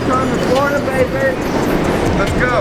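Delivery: hyped shout. Real, not acted. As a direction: shout it like a celebration. Hyped arrival in Florida.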